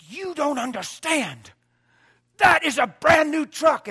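imitating the lady yelling at him; vehement yell. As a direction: snap back in distress. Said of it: "she yelled at me".

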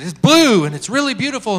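loud, high blurted answer; manic excitement. Retold or imitated. Woman answering what color the ocean is, manic excitement. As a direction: imitate an eager, instant reply. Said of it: "she was manic".

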